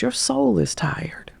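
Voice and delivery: slow, hushed inner voice; whispered realization. Retold or imitated. An inner voice telling her the truth, slow and whispered. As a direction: whisper it like a revelation.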